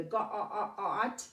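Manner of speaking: Ga-, ah, ah, ah, t with a short ah vowel; short O mistake. Shown as a demonstration. Teacher showing kids the wrong short O. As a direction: sound goat wrongly with a short ah. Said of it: "put a short O in there".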